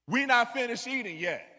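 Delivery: loud, puffed-up pushback; said with my chest. Retold or imitated. Boy talking back to counselor, loud and puffed-up. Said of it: "I said it with my chest".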